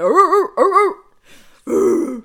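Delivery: loud barking yelps; dog bark imitation. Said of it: "I wanted to go".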